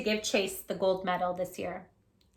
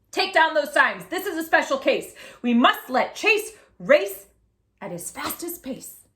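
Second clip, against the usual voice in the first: loud, raised official order; loud commanding race official; firm command; 5 semitones higher, 7 dB louder than usual. Acted. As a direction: order them to act now.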